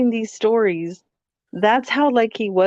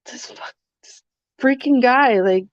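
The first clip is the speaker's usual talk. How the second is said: higher, exasperated mock scolding; annoyed disbelief; 5 semitones higher than usual. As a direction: tease him, are you stupid, half laughing. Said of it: "And I'm just like".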